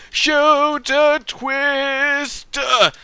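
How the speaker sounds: slow, high, stretched show-host call; drawn-out announcement. Acted. Announcing build challenge name.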